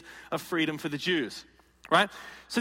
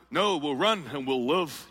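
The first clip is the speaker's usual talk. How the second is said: lower, pleading voice of a scared soldier; cowardly soldier; 5 semitones lower than usual. Acted. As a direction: beg to run away.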